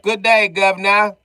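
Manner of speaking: high, slow, hammed-up British toff; loud mock British gloat. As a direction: play a posh Brit after the kill. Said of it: "be like".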